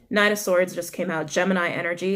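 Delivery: ordinary talk, nothing marked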